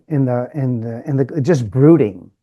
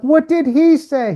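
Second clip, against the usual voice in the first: much higher, incredulous question; stunned question; 14 semitones higher, 5 dB louder than usual. Retold or imitated. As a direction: ask in disbelief, pitched way up. Coach stunned by student's reply.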